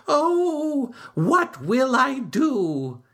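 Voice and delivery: high, slow worried wail; high, anxious turtle voice; crying out. A narrator's reading. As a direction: wail it, desperate and frightened. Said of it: "cried Turtle".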